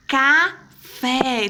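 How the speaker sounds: "Café" split in two with a clear gap; syllable by syllable. Shown as a demonstration. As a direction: say "Café" as two separate beats, ca, then fé. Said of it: "pronuncia a sílaba e vai".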